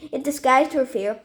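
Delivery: ordinary delivery, nothing marked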